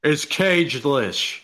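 very slow, drawn-out celebrity impression; Nicolas Cage. Naming the real number one accent, drawn-out Cage voice. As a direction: stretch it out in a playful actor impression. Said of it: "speaking with the Cage accent".

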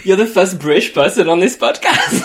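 amused jab ending in laughter; laughing tease. Real, not acted. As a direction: crown the guest mockingly while cracking up. Teasing guest while laughing.

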